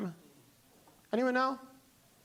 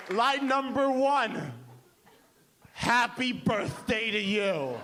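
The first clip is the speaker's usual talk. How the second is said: grand reveal; 2 semitones higher, slower than usual. Retold or imitated. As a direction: present it like a masterpiece. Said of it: "she's like".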